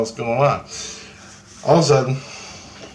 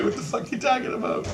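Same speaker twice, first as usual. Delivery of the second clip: very high, softer mock-baffled voice; retold bewilderment; 14 semitones higher, 6 dB quieter than usual. Retelling his answer to the cheering crowd, high baffled voice. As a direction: squeak out your own baffled reply. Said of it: "And I'm like".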